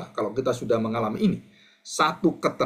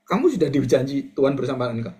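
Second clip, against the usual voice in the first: normal talking voice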